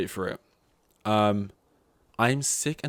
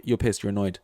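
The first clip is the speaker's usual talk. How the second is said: ordinary delivery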